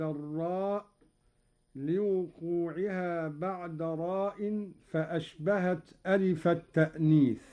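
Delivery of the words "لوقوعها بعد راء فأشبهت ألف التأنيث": normal talking voice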